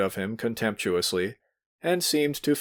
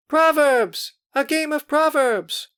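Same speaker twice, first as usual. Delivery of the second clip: high, loud excited shout; girls shouting; 5 dB louder, slower than usual. A narrator's reading. Girls shouting for a party game, high and loud. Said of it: "the girls shouted on all sides".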